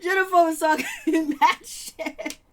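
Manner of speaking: slow, laughing through words. Real, not acted. Laughing while telling viewers about Jennifer. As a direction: let the laugh break the line.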